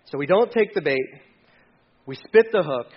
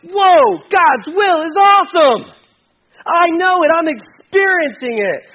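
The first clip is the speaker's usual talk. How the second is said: high, loud, drawn-out elation; amazed excitement; 10 semitones higher, 8 dB louder, slower than usual. Acted. Believer discovering God's will first-hand, loud amazement. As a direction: exclaim it high with awe. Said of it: "then we're gonna go".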